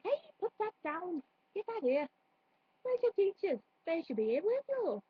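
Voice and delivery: put-on high, lilting Welsh accent; shopkeeper's sing-song Welsh telling-off. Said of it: "it's basically a Welsh accent".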